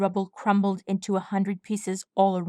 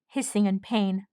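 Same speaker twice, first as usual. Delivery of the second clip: plain reading voice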